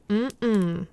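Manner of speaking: usual speaking voice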